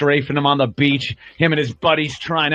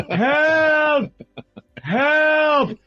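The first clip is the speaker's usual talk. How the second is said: a far higher, strained cry of help; old man yelling. Retold or imitated. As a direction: shout it like a lost old man calling from down the hall. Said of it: "he just yells help for nothing".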